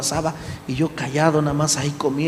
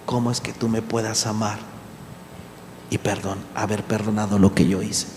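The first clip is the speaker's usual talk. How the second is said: softer, heavy and subdued; somber retelling; 7 semitones lower, 6 dB quieter than usual. Retold or imitated. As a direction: let the grief weigh each phrase. Weeping stepfather asks forgiveness.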